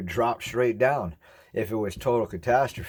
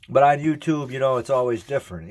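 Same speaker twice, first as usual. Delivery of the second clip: animated; 6 semitones higher, 6 dB louder than usual. Real, not acted. Contrast with whisper channel.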